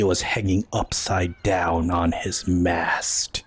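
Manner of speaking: low, slow, deliberate confession; secretive menacing admiral. Acted. Admiral privately revealing he killed a captain, low and menacing. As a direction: tell it low and unhurried, as a veiled threat. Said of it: "he whispers so only you hear".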